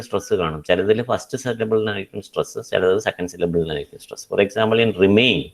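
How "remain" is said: "remain" stressed on MAIN; re-MAIN, weight on the end; stress on second syllable. Shown as a demonstration. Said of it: "second syllable main is given stress".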